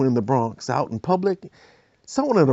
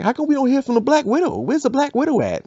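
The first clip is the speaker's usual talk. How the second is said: higher-voiced bystander asking; lightly voiced nosy crowd; curious onlooker; 11 semitones higher than usual.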